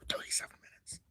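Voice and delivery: hushed whisper to oneself; whispered surprise. Real, not acted. Streamer checking how long the stream has run, whispered. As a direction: whisper it under the breath.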